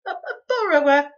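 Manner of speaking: high, loud tearful cry; boy's cry. A narrator's reading. Boy denying he is a thief, high tearful cry. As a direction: cry it out high, lips trembling. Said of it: "he repeated".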